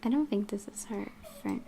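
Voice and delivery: usual speaking voice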